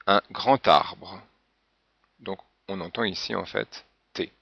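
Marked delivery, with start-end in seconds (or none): 0.0-1.2 "grand arbre" slowly, the D linked as a T: "gran-t-arbre"; slow liaison demo. Shown as a demonstration. Teacher showing learners the D of "grand" said as T, slow. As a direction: draw out "un grand arbre", the final D sounding as T. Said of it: "le D en position finale se prononce comme un T".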